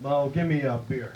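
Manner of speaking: low, unhurried cowboy voice; a touch of the Duke; lightly voiced John Wayne. John Wayne ordering from the bartender, low cowboy voice. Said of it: "He said".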